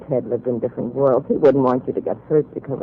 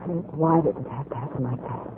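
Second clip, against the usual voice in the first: grieving; 10 dB quieter than usual. Acted. Grieving girlfriend asks why.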